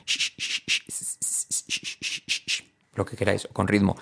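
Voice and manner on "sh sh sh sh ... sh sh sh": voiceless SH and S in a beat; rhythmic sh/s drill. Shown as a demonstration. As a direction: chant the consonants 'sh' and 's' without voice. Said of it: "o hacer canciones".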